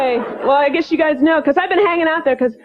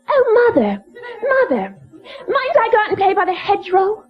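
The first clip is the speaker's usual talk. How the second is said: put-on higher-pitched posh little British girl, pleading; hammed-up British child begging on and on; snotty British daughter; 5 semitones higher than usual. An imagined British daughter begs her American mother to play outside, snotty and pleading. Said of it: "one snotty little daughter".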